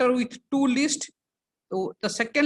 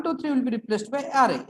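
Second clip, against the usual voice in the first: ordinary talk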